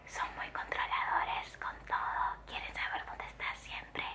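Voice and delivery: soft unvoiced whisper. Real, not acted. Vlogger confiding about controlling officials, in a hushed whisper. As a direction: lean in and whisper it like a secret. Secretive remark about China.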